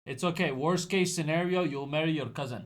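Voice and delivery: ordinary talk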